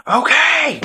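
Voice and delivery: high, loud exasperated outburst; losing it. Retold or imitated. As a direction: burst out like someone losing it. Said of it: "Stiles is completely losing his shit".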